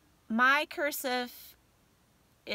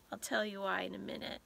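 usual speaking voice

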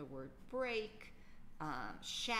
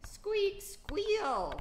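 high loud squeal; squeaky brake noise; 8 dB louder than usual. Shown as a demonstration. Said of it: "that squeaky brake noise".

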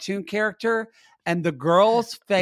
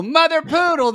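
mock bar greeting; 11 semitones higher, 3 dB louder than usual. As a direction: call it out like a barfly greeting a regular. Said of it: "People don't walk in and go".

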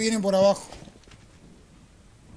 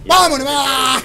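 loud, higher triumphant yell; victory shout; 4 semitones higher, 11 dB louder, slower than usual. Real, not acted. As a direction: shout the win to the team. Cheering the win.

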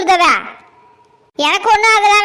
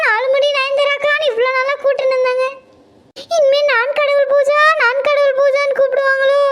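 higher, aggrieved self-pitying daughter-in-law; fed-up daughter-in-law; 6 semitones higher than usual. Acted. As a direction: rant like a pampered bride worn out by housework. Daughter-in-law keeps ranting.

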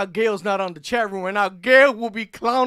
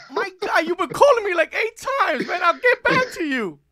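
exasperated reply; 10 semitones higher, 5 dB louder than usual. Retold or imitated. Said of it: "And I'm like".

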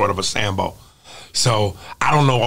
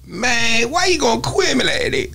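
high, mangled rap slur; mock today's garbled hip-hop diction; mumbling hip-hop impression; 11 semitones higher than usual. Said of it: "That's today's hip hop".

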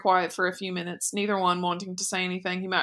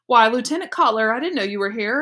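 higher, louder mock surprise; Gretel feigning surprise; 4 semitones higher, 6 dB louder than usual. Boy mimicking his sister greeting the lieutenant, mock surprised. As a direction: act surprised to see him, transparently put on. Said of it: "pretends that she was there".

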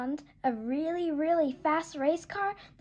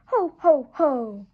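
big, booming ho ho ho, much louder; Santa laugh; 10 dB louder, slower than usual. Acted. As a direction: belly laugh like Santa Claus. Santa hat appears in story.

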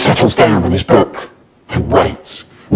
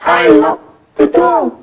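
much higher, slow, timid squeak; weedy little voice; 14 semitones higher, slower than usual. A narrator's reading. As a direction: confess it in a tiny weedy voice. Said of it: "In a weedy little voice I say".

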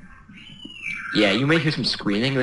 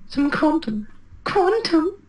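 very high squeaky mimicry; silly high-pitched repeat; mocking echo; much slower than usual. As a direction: parrot it back in a falsetto. Mimicking the other player's words.

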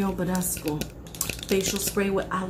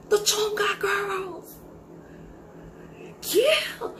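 high squealed news; excited squeal; 10 semitones higher, much slower than usual. Acted. Excited gossip on phone.

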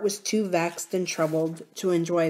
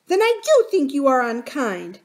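hurt nurse; 7 semitones higher, 6 dB louder than usual. A narrator's reading. Nurse reproaches tearfully.

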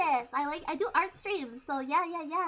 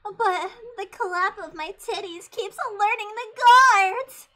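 louder and higher, mock complaint; hammed-up exclamation; 5 semitones higher, 9 dB louder than usual. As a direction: build to a loud, theatrical outburst. Acting out a silly sneaking bit.

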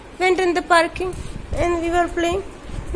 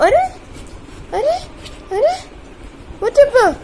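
startled exclaim; 8 semitones higher, much faster than usual. Real, not acted. Parent startled when the baby lashes out, exclaiming.

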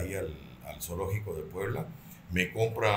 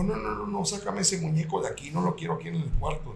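much higher, pleading wife's voice; frightened wife; 9 semitones higher than usual. Retold or imitated. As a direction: play the scared wife begging to get the doll out. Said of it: "Me dijo". (esposa asustada, voz mucho más aguda)

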